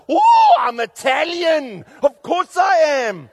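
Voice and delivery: slow, loud and high, Italian mother shrugging it off; proud loud mother. Retold or imitated. A loud mother tells the pastor she's Italian, slow proud shout. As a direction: boom it out like it's obvious.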